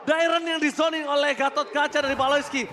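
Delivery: shouted, stretched hype call; fighting game announcer. Acted. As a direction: drag out the big call. Caster shouting kill recap.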